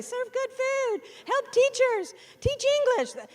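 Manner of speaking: high, sing-song mock publisher line; mock publisher pitch. Publishers' pretend slogan, mocking high voice. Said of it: "They wanna say".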